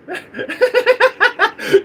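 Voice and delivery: high-pitched burst of laughter; real high laughing fit. Real, not acted. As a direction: laugh loud and high at a comment. Laughing at chat comments.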